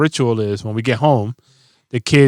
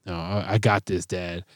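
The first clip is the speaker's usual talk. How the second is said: softer, low determined refusal; stubborn little kid; 5 semitones lower, 10 dB quieter than usual. Retold or imitated. Daughter refusing her dad's help with her hair, softer and stubborn. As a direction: quietly insist on doing it herself. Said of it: "She's like".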